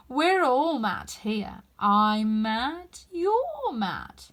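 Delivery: slow, drawn-out knowing cat; sly cat taking its time; lazy Cheshire Cat. A narrator's reading. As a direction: savour each word like a grinning cat.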